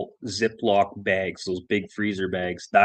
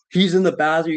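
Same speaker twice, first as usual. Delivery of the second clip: ordinary talk, nothing marked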